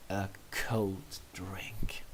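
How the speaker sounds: slow low hushed wish; longing whisper. A narrator's reading. You wishing for a cold drink, in a slow whisper. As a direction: whisper it dreamily, slowly.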